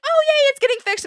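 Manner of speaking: sing-song fake glee at the repair van; mock happy cheer. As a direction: chirp it like a thrilled cartoon. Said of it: "you wanna have a happy feeling".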